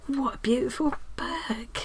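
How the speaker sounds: slow, hushed, unvoiced; awed whisper. Real, not acted. Whispering to viewers over the new book. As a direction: whisper it in wonder.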